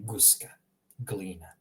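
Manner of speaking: "guska, glina" with the g of goose; GOOS-kah, GLEE-nah for "guska, glina"; hard G. Shown as a demonstration. Teacher recaps for learners, "guska, glina" with hard g. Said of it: "how you pronounce it in Croatian".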